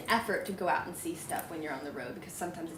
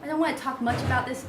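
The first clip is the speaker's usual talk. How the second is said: ordinary talk, nothing marked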